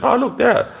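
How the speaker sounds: a passerby pointing in fake delight; mock onlooker. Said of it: "Everybody's just like".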